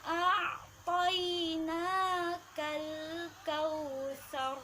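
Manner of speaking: slow melodic tajwid chant; slow chanted Quranic recitation. Shown as a demonstration. Class repeats verse together.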